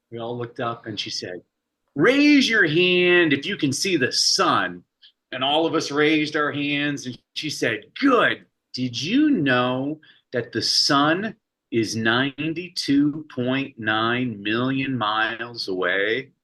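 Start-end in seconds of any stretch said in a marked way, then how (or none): 1.9-4.8 higher, slow, louder teacher voice; spring the surprise on the class; teacher's final question. Retold or imitated. 8.0-16.3 teacher revealing the lesson. Retold or imitated.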